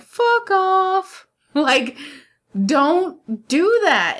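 high, drawn-out retort; exasperated dismissal. Retold or imitated. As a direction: toss it out high, in mock disbelief. Retold reaction to private message.

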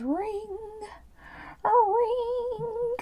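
very high, drawn-out ringing; imitated phone ring.

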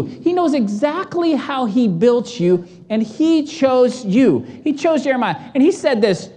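ordinary delivery

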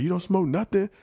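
lightly voiced seller, high and doubtful; mock hustler. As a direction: push the question up high. Hustler pushes.

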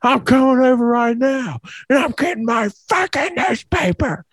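high, strained mock yelling; furious caller impression, far up in pitch; imitated flipping out. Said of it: "starts flipping out".

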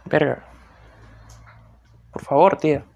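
loud fed-up exclamation; loud exasperated swearing; exasperated outburst. Real, not acted. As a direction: blurt it out, sharply annoyed.

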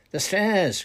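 high, loud shout; crying out. A narrator's reading. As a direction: shout it out as an alarm. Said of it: "cried Dawlish".

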